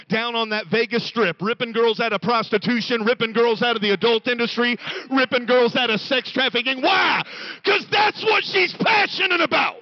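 loud, high preacher's build to a shout; preaching at full force. Acted. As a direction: drive it home from the pulpit. Preaching crescendo.

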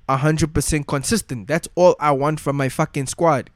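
usual speaking voice

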